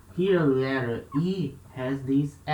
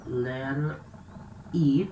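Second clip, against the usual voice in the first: ordinary talk, nothing marked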